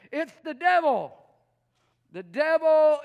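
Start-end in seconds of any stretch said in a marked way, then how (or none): 0.1-1.2 mock blaming. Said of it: "Some of you say".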